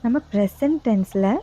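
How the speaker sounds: slow, even yoga nidra guidance with long pauses; guided meditation. Real, not acted. Yoga teacher guiding listeners lying down to relax each body part, guided meditation. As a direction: lead them unhurriedly into rest, phrase by phrase.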